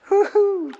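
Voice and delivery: high joyful woo-hoo; excited whoop. Real, not acted. Floating in cold pool.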